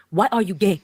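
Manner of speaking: imitating an African reporter's accent. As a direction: put on an African accent for the question. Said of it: "in this African voice is just".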